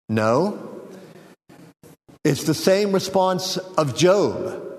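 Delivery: usual speaking voice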